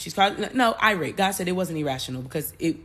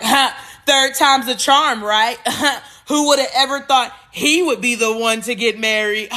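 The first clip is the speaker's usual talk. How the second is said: loud, high mocking laughter through the words; hammed-up guffawing tease at the groom's expense; jeering asshole friend; 4 semitones higher, 5 dB louder than usual. Groom's colleague at rehearsal dinner, jeering with laughter. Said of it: "like, really a asshole".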